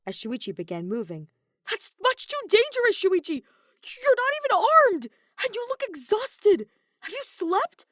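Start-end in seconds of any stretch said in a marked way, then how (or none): 1.7-7.9 much higher, loud anxious scolding; panicked caretaker pleading with a reckless friend; worried robot friend. Acted. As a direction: fret over him like a worried friend.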